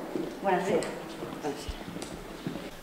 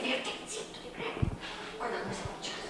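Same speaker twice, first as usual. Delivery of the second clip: hushed scolding whisper to the client; a sharp whispered rebuke under the breath; whispered aside; 13 dB quieter, faster than usual. Acted. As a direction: whisper it so the jury can't hear. Lawyer hisses aside at client.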